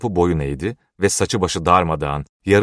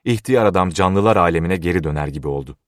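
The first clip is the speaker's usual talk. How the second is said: ordinary delivery, nothing marked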